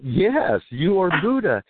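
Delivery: high-pitched, insistent retold yes; emphatic confirmation. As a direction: act out finally getting through to someone. Emphatic reply in retold exchange.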